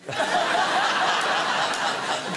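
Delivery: loud burst of laughter; big laugh. Real, not acted. Laughing with the audience at his kids, loud. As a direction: laugh hard at the punchline.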